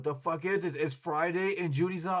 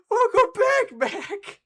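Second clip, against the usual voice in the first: excited; 11 dB louder, much slower than usual. Real, not acted. Greets returning viewer while laughing.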